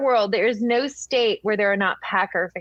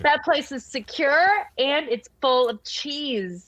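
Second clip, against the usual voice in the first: mock awe; 5 semitones higher than usual. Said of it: "You're like".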